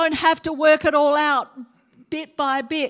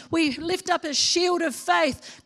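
ordinary talk, nothing marked

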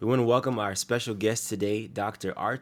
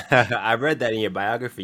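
laughing; 2 semitones higher, 8 dB louder than usual. Real, not acted. Host laughs at guest's joke.

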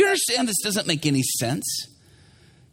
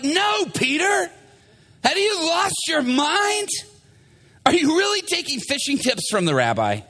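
incredulous crewmates; 8 semitones higher than usual. Acted. Crewmates refusing Peter's plan, incredulous. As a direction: balk at the crazy idea, pitched up. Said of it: "They're like".

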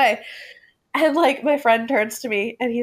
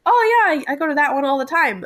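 eager gush; 6 semitones higher than usual. Retold or imitated. Eager reply to cop.

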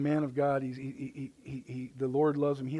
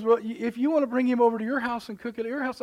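higher-voiced sister, mildly put out; sister's reply; 9 semitones higher, 5 dB louder than usual. Sister telling him to cook elsewhere, higher and mildly annoyed. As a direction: lift the voice and push the job back on him. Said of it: "She goes".

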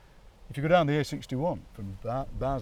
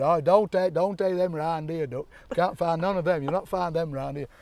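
put-on loud, higher broad Chesterfield accent; 4 semitones higher, 6 dB louder than usual. Local waving off accent hunters, loud Chesterfield accent. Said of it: "a proper Chesterfield accent".

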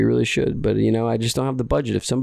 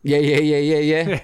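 dismissive yeah-yeah; 3 semitones higher, 3 dB louder than usual. Retold or imitated. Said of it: "very annoying when I hear people say".

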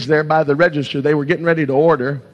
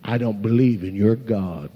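low, soft dismissive quote; understated put-down; mild mocking unbeliever; 5 semitones lower, 5 dB quieter than usual. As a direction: lightly imitate a scoffer, dropped low and quiet. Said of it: "and they may mock you".

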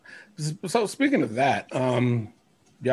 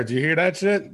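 ordinary delivery

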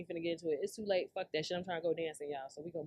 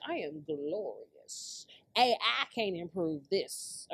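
mock diva; 6 semitones lower, 4 dB quieter, much slower than usual. Admiring own looks on filter.

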